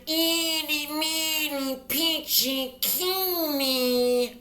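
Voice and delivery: high, nasal chant; nasal resonance. Shown as a demonstration. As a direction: place it up in the mask. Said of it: "if you're trying to put it in here".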